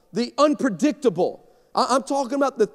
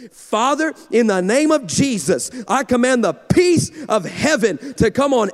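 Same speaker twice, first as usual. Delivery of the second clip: prayer; 3 semitones higher, 5 dB louder than usual. Retold or imitated. Daughter praying on air.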